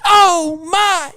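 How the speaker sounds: high, bright mock exclamation of wonder; mock surprised cry. Reading a fan's letter, imitating the clip's high cry. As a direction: squeal it like a soundboard clip. Hears a call in the distance.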